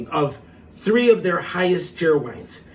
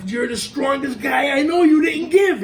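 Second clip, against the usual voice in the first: loud, higher cheering yell; proud shout; 10 semitones higher, 5 dB louder than usual. Retold or imitated. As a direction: boom it out like proud sons. Sons praising proud father.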